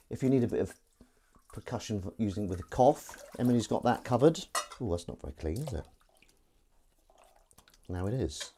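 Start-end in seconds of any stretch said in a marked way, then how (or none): none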